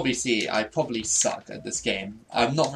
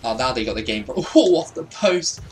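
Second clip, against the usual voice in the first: usual speaking voice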